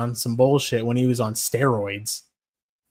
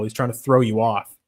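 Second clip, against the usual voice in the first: normal talking voice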